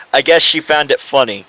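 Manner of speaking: ordinary talk, nothing marked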